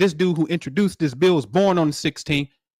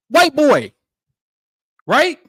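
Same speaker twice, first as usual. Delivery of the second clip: excited callout; 8 semitones higher, 6 dB louder, slower than usual. Real, not acted.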